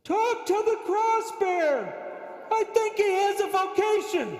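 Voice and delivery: Father Fred. Acted. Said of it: "Bullwinkle just told me".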